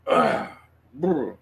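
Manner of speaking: loud throat-clearing ugh, then a brr; shaking off emotion. Real, not acted. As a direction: shudder it off with a noisy brr. Shakes off getting emotional.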